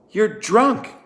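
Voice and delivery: high, loud accusing yell. A narrator's reading. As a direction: throw it at his face. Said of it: "she yelled".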